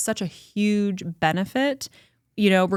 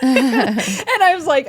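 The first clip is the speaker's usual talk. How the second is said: slow laughing; 6 semitones higher, 6 dB louder, much slower than usual. Real, not acted. Laughing about her strong friend.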